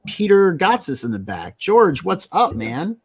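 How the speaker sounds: ordinary delivery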